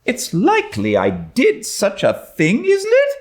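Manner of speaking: high, rising scornful retort; mock the very idea. A narrator's reading. Diana to Anne, with scorn. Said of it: "said Diana with just scorn".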